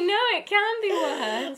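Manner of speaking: slow, high, loud reply through laughter; high, laughing comic protest; laughing mock retort. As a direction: stretch it out while cracking up.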